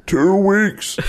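high-pitched, put-on voice; put-on quote voice. Player echoing the two weeks line, silly high voice.

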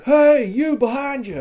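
loud, high, drawn-out yell from behind; battle shout. Pretend. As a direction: yell to startle the enemy.

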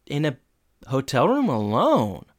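slow teasing singsong; drawn out, knowing tease; knowing Eric. Retold or imitated. Eric teasing the couple about prom night, slow and sly. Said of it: "And Eric goes".

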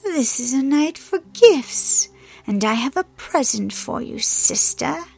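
loud, high, mocking relish; sardonic mirth. A narrator's reading. Hel offers her sister a gift with sardonic mirth. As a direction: lift the last word into a taunt. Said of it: "she replied with sardonic mirth".

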